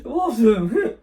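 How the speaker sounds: loud, high, excited shout of Awesome!. Real, not acted. Vlogger cheers about doing the music, loud and excited. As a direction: burst out with delight.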